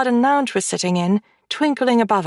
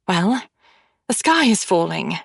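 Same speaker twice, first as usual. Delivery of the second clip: ordinary delivery, nothing marked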